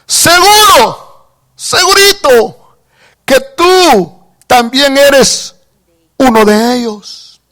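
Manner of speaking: slow, high teasing accusation; accusing bystander. Acted. Bystanders accusing Peter, slow and sly.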